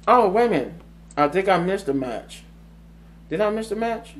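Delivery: high, puzzled self-questioning; sudden doubt. Real, not acted. Host realising a match was skipped, real puzzled surprise. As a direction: catch yourself and wonder aloud, pitch lifted.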